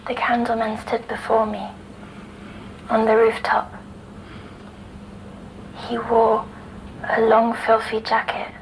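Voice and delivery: soft, half-whispered telling; hushed recollection. Acted. Girl quietly telling the doctor of the Candleman. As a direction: recall the figure as if afraid to wake it. Girl recounting a nightmare figure.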